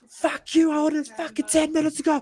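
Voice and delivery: high, strained tirade; hammed-up cursing out of the cook; mock fury pushed way up in pitch; raging waiter.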